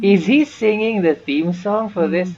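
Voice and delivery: high, loud, disbelieving rise; can you believe this; incredulous question. Real, not acted. Streamer notices character singing.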